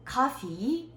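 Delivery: high, rising "Coffee"; acting a student asking with just "Coffee"; one-word learner's question. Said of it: "단어 하나만 말해도 다 알아듣는". (단어 하나로 묻듯 끝을 올린 "Coffee")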